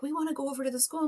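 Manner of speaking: lightly voiced pleading student; mock kid voice. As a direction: rattle it off like an eager child. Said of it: "A couple of them would be like".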